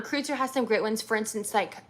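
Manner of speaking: ordinary delivery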